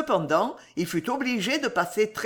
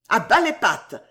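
louder, sharp indignant order; indignant cry; 3 semitones higher, 6 dB louder than usual. A narrator's reading. Sam fending off Job's embrace, indignant. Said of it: "lui cria Sam, grandement indigné".

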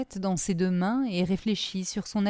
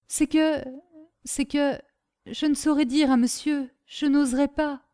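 halting, higher-pitched reluctance; timid hesitation; 6 semitones higher than usual. Acted. Servant dares not tell her master, timidly. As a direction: falter as if not daring to speak.

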